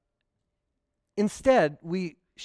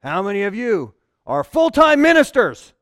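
loud, higher booming challenge; mock pastor catching first-timers out; imitating the old pastor; 6 semitones higher, 12 dB louder than usual. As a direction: boom it out to catch the crowd. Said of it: "and he'd go".

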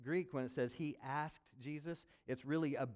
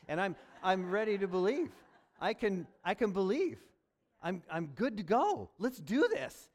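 high, loud eager resolve; fired up and ready to go; eager readiness; 7 semitones higher, 8 dB louder than usual. Retold or imitated. Man's faith switches on.

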